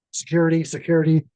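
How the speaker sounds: mock alarmed call-out; handler calling security. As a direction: imitate a handler summoning help. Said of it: "her handler goes".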